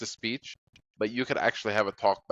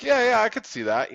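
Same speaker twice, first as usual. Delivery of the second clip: high, louder over-eager agreement; agreeable chatbot impression; 12 semitones higher, 5 dB louder than usual. Chatbot going along with a silly claim, mock agreeable. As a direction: play a chatbot agreeing far too readily. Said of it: "They're programmed to agree with you".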